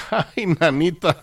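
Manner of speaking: high, laughing "ay, nanita" in disbelief; laughing exclamation. Real, not acted. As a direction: laugh it off in amazement. Reacting to "more than a hundred.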